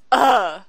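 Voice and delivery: put-on high teenage groan of disgust; exasperated ugh. Daughter fed up with dad's talk, exasperated groan. Teen's exasperated reaction in acted quarrel.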